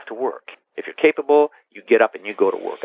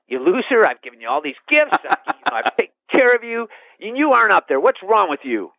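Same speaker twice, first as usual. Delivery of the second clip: high, scolding mock rant; mean voice; 10 semitones higher than usual. God imagined scolding the man in the pew, mean voice. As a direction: berate someone in a higher, exasperated voice.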